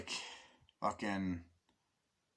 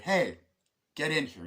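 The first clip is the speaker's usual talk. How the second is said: loud, higher welcoming call; 5 semitones higher, 5 dB louder than usual. Retold or imitated. Man calls his friend in from the cold, calling out. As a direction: call someone in from outside.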